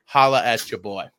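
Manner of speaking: ordinary talk, nothing marked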